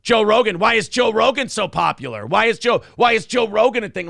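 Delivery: worked-up mock griping; mock indignant complaint. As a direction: mimic someone loudly fed up, raising the pitch. Mimics someone's repeated complaint.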